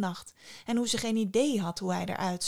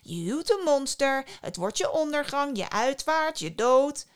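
loud, raised warning of doom; dramatic older sister, mounting to a shout; crying out; 5 semitones higher, 5 dB louder than usual. A narrator's reading. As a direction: drive each item home louder. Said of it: "riep haar oudste zus uit".

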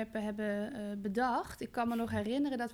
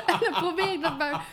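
laughing through the words; giggling while explaining; 6 semitones higher, 6 dB louder than usual. Real, not acted. Lacht om eigen verhaal.

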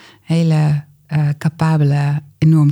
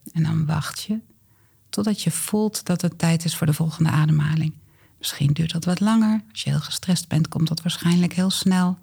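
calm guided exercise voice; walk them through it gently; guided breathing; 4 dB quieter than usual. Acted. Leads the exercise together.

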